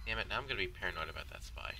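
usual speaking voice

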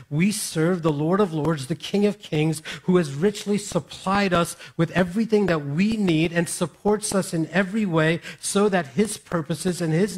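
loud, driving pulpit voice; fervent preacher proclaiming God's provision; full-force preaching. Acted. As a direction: preach it out to the whole room.

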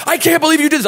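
high mock yell of disbelief; angry yell. Imitating the owner yelling in disbelief at the driver. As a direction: shout it, outraged.

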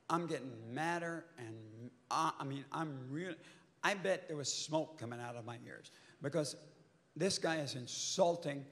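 ordinary talk, nothing marked

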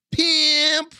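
playful exclaim. Real, not acted. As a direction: blurt it with cheeky glee. Cheeky comment.

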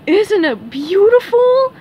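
high, loud, slow exclamation; admiring Patrick. A narrator's reading. Patrick shows SpongeBob the huge egg, marvelling. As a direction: gush over it, drawing it out. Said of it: "cried Patrick".

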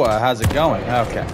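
ordinary talk, nothing marked

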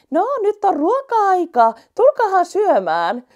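high, loud, slow call to dinner. Acted. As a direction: call everyone to eat from afar. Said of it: "huuteli kolmas". (kovaa huutelua ruokailuun)

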